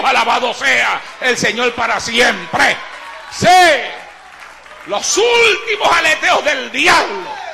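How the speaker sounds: loud, high, shouted sermon with stretched key words; full-force preaching. Real, not acted. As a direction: build relentlessly to a roaring climax.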